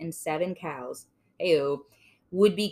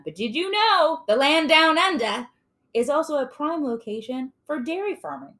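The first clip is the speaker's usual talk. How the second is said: raised, put-on Australian announcer accent; 7 semitones higher, 4 dB louder than usual. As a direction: pitch it up in a mock Australian accent. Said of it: "You gotta hear the accent".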